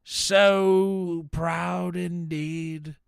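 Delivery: slow, loud, high proud exclamation; drawn-out theatrical swell of pride; gushing pride. Real, not acted. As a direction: stretch every word out in overblown pride.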